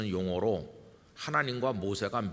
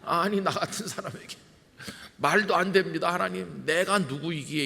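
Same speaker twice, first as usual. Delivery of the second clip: flustered refusal; 7 semitones higher than usual. Retold or imitated. Moses refusing God, flustered and high-pitched. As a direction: wave it off in a panic. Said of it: "사투리로 말하면 우얄꼬 이렇게 하는 거예요".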